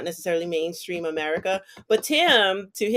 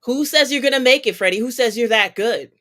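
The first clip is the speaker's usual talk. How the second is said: louder, higher taunting challenge; mock challenge; 5 semitones higher, 7 dB louder than usual. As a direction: needle him like a doubter.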